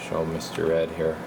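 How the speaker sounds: ordinary delivery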